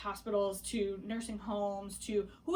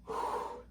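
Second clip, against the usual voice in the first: long breathing through a contraction; heavy breath out; 4 dB quieter than usual. Shown as a demonstration. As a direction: blow the breath out hard. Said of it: "like that, it was that bad".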